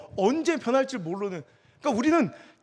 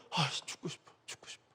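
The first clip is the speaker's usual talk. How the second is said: much softer, lower, fading sigh of despair; despairing murmur; 6 semitones lower, 12 dB quieter than usual. Retold or imitated. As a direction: mumble it to yourself, hopeless. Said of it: "나도 모르게".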